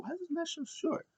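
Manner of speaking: very high, soft squeaky complaint; mock whining disbelief. Fan retelling his reaction to the match, squeaky disbelief. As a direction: squeak out a baffled question.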